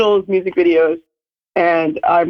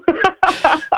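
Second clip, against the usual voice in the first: delighted giggle; 11 semitones higher than usual. Real, not acted. Reacts to flattering intro.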